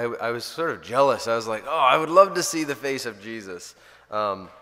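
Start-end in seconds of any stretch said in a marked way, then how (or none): 1.7-3.8 higher and louder, full of yearning; eager longing. Retold or imitated. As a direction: wish it out loud, swept up. His own longing quoted.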